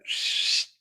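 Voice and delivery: whispered shush; whispered shh to keep a secret. Acted. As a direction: hush them with a breathy shh. Shushing in an acted scene.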